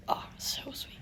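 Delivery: breathy hushed whisper of delight. Real, not acted. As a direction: whisper it like a secret treat. Savoring the mango sauce.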